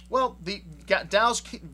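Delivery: ordinary talk, nothing marked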